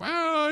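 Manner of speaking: high wailing oh, acting out baby Krishna; mock baby cry. Storyteller imitates baby Krishna crying when picked up, mock wail. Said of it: "he starts crying".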